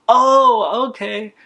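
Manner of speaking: high, loud startled "oh, okay"; awkward surprise. Retold or imitated. The son caught hiding, answering the customer in high startled surprise. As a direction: yelp it out, caught off guard.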